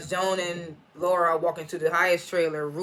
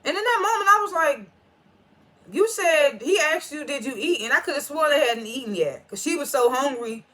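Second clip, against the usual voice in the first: worked up; 7 semitones higher than usual. Real, not acted. Venting to viewers about the meal, indignant. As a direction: protest it, all worked up. Outraged reaction to the scene.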